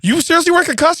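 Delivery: high, stunned disbelieving question; a high shocked mock question; incredulous. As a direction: ask it as if you cannot believe it. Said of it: "And I went".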